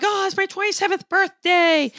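mock-excited high young guy, announcing his birthday; excited birthday boy. Imitating the excited birthday boy at sushi, mock-excited and high. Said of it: "And they're really excited".

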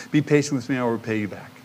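usual speaking voice

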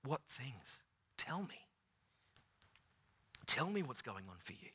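Jesus softly asking the grieving disciples; compassionate ask. Retold or imitated. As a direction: draw them out tenderly. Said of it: "He steps in their story and says".

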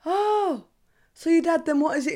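high, loud insistent question; nosy coworker. Retold or imitated. Coworker digging into her dad's background, high and loud. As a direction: push the prying question way up high. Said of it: "And it's like".

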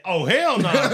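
high, loud laughing "hell nah"; laughing through a mock refusal. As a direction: turn him down while cracking up. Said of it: "I said".

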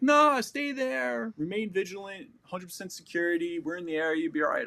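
a smug officer brushing him off; mocking the commander. Commander's radio answer mocked.

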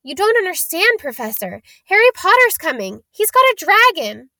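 high, urgent protest; pleading schoolboy. A narrator's reading. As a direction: plead your case in a high voice. Caught boy pleading with professor.

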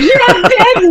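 high, laughing mock receptionist voice; laughing agreement. Receptionist laughing while agreeing the rubber line sounded dirty. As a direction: giggle it out as the amused receptionist. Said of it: "She's laughing".

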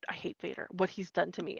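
a hushed, bitter confession; whispered hatred. Retold or imitated. Casterfo confiding his hatred.

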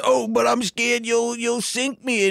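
high, timid frog voice; scared frog. Retold or imitated. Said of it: "And the frog says".

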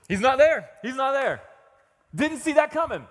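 loud, high exclamations of disbelief; mock dismay. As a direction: cry out as if utterly blindsided. Acts sudden loss of confidence.

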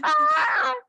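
very high squeal of delight; romance-reader scream; excited squeal. Real, not acted. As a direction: shriek with swooning excitement. Excited about the scene.

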